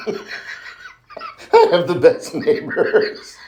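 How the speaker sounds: drawn out through laughter, high; laughing. Real, not acted. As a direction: say it while laughing slowly. Laughing while delivering.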